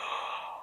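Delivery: quick breathless intake of air; sharp gasp. Retold or imitated. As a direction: gasp in shock. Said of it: "I went".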